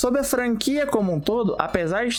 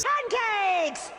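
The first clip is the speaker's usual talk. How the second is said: cartoon shout; 12 semitones higher, 4 dB quieter than usual. Crash shouting pancakes.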